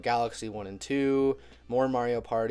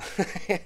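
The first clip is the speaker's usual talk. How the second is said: short high-pitched laugh; amused high giggle; 8 semitones higher than usual. Real, not acted. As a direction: break into a laugh. Cracking up recalling Seth Rogen's laugh.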